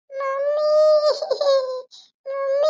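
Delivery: high wailing call for mummy; crying child. Acted. Fallen child wailing for mummy, crying. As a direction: cry out high, drawing out the word. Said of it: "ಯಾಕೆ ಅಳ್ತಾ ಇದೆ ಮಗು".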